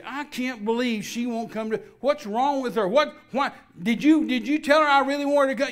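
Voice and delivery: rising to a loud, high-pitched exasperated complaint; indignant outburst. Retold or imitated. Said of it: "every now and then I'd say".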